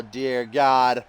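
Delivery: normal talking voice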